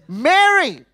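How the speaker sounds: calling Mary out loud and high; urgent loud call of a name; exclaimed name. Shown as a demonstration. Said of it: "with an exclamation mark".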